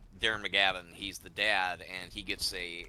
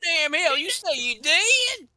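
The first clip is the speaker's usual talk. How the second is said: much higher, louder twangy Midwest voice; 7 dB louder, slower than usual. Pretend. Host reacting to a teammate's kill as the neighbor, Midwest accent.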